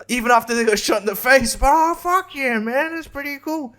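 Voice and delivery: sarcastic squeaky cheer about the year; mock chipper voice. Mocking people who think the year went well, squeaky chipper voice.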